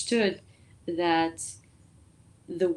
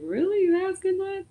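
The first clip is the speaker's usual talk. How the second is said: surprised; 13 semitones higher than usual. Retold or imitated. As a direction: ask it stunned. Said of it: "I'm like".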